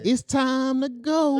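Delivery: high, drawn-out mock sweetness; ominously smiling mom. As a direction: imitate mom smiling as she announces it slowly. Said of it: "did was just smile".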